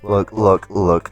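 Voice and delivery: normal talking voice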